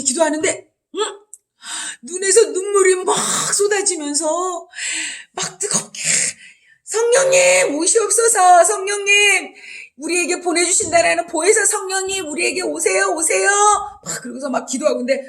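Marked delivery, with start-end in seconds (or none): 2.0-6.0 tearful crying prayer. Acted. Acts tearful prayer for Spirit. 6.9-13.5 crying out in prayer. Acted. Cries out prayer to the Spirit.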